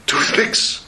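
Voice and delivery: low breathless toothpicks, almost whispered; a hushed, shocked echo; stunned disbelief. Acted. Father hears the son bought toothpicks, hushed disbelief.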